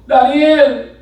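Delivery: high, loud, slow cry; drawn-out shouted summons; calling out loudly. Acted. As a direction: call slowly down into the pit as the king. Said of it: "llamó a voces".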